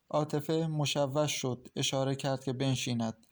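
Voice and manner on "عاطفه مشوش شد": plain reading voice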